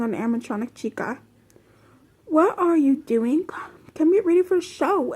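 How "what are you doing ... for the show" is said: higher-pitched character voice, mildly pressing; lightly voiced cool performer rounding up a bandmate. A narrator's reading. Freddy calling Chica to get ready for the show, cool voice. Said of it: "a cool voice said".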